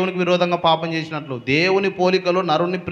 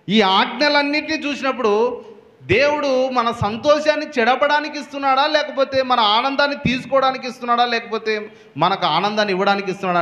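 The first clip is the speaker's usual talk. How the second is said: higher, rising preacher's rhetorical questions; impassioned preaching; 8 semitones higher than usual. Acted. Preacher challenging the congregation, impassioned and high. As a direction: preach it out to the whole church with fire.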